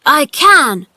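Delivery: high, loud, slow protest; shouting. A narrator's reading. Boy denying he is crippled, shouting. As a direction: fling it back defiantly. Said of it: "he shouted".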